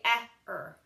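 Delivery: eh, er split into two sounds; eh, er sounded out in two parts; split ending. Retold or imitated. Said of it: "they would say".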